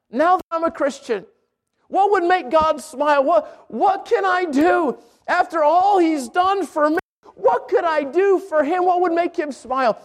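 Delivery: high, slow, sincere inner voice; pleading, tender believer's prayer; earnest believer. Pretend. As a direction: voice a heart longing to please God. Preacher voicing a sincere believer.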